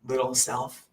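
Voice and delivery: put-on high, soft and tender, like soothing a child. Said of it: "I came in as my higher self and I said".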